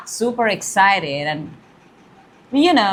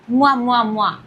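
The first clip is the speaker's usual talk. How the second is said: blown kisses. Real, not acted. Blowing kisses at the card.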